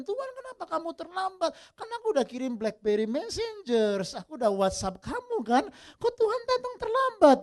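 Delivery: high, whiny mock complaint to the Lord; complaining Martha. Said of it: "Satu komplain". (Marta mengeluh dengan suara tinggi merengek)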